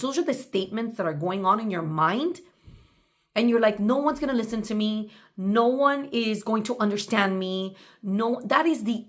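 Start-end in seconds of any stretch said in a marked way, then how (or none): none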